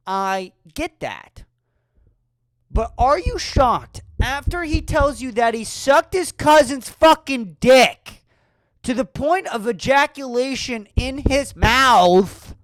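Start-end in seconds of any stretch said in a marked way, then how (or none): 2.7-12.6 loud outraged build, stretched; loud rant. Acted. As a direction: yell the outrage at the crowd.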